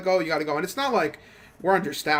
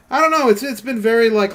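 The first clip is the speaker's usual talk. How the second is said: louder, higher burst of frustration; frustrated outburst; 5 semitones higher, 7 dB louder than usual. Real, not acted. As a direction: blurt it out, fed up.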